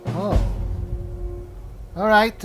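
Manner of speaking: usual speaking voice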